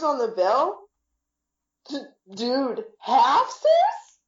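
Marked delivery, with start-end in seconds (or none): 1.9-4.2 high disbelieving squeak on halfsters; rising, shocked dude halfsters; incredulous. Retold or imitated. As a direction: act stunned by the cheap offer. Incredulous at splitting the bill.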